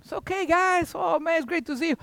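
high, fast fond dog talk. Retold or imitated. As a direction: gush over the dogs. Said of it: "I talk to him".

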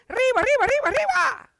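squeaky high cartoon mouse shouting arriba and ándale; Speedy Gonzales. Preacher doing the fast cartoon mouse for laughs, high and loud. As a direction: pitch way up and rattle it off like a racing mouse. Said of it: "that's what Peter".

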